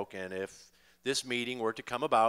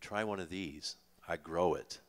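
softer and lower, a gentle fatherly offer; 6 semitones lower, 4 dB quieter than usual. Retold or imitated. Offering Paul a homegrown joint, softer fatherly tone. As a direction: hand it over like a kindly dad. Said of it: "accompanied with a fatherly".

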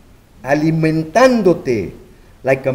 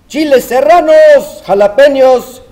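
loud, high hawker cry; vendor calling out; 9 semitones higher, 8 dB louder than usual. Acted. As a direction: sing out the names to the crowd.

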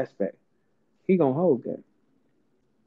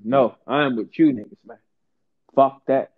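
usual speaking voice

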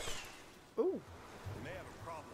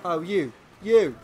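ordinary talk, nothing marked

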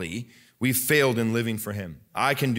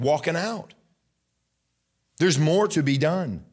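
higher, rising preacher's exhortation; fiery sermon build-up; preaching at full force; 5 semitones higher than usual. Acted. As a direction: drive the point home from the pulpit.

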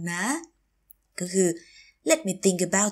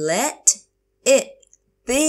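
word by word; 4 semitones higher, 5 dB louder, much slower than usual. Shown as a demonstration. Teacher repeats phrase word by word.

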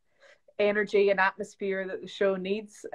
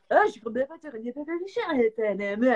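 gibberish French; 4 semitones higher than usual. Retold or imitated. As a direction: fake French commentator babble, starting high. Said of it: "But it was total gibberish".

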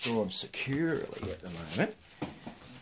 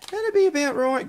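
excited; 8 dB louder than usual. Real, not acted. Excited over the auction price.

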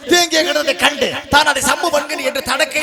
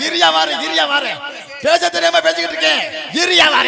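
shouting; 5 semitones higher, 5 dB louder than usual. Real, not acted. Heated quarrel with reciter.

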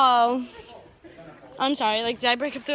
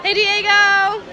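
loud, higher greeting; calling out; 6 semitones higher, 7 dB louder, slower than usual. Real, not acted. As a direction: call over to someone passing by. Calling out to Diego passing by.

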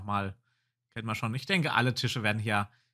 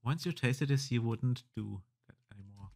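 softer second reading; 3 semitones lower, 6 dB quieter than usual. Shown as a demonstration.

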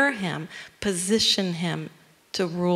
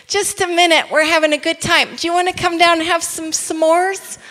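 loud, high, cheerful shout back; Abednego calling up; 9 semitones higher, 7 dB louder than usual. Acted. Man in the furnace inviting the king down, cheery shout. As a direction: call up happily, in no hurry to leave. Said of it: "Abednego looks up and says".

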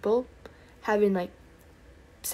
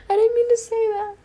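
high, loud laughing squeal; real helpless embarrassed laughter; 14 semitones higher, 8 dB louder than usual. Real, not acted. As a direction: squeal it out, mortified at your own slip. Embarrassed laughing at own slip.

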